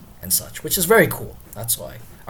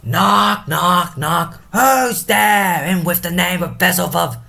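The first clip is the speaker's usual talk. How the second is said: loud, high theatrical shout at the door; calling out; 7 semitones higher, 5 dB louder, slower than usual. Acted. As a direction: bellow like a drunken gatekeeper demanding who knocks. Porter calls out to knockers.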